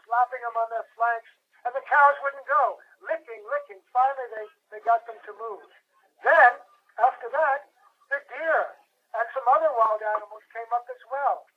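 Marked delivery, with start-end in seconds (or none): none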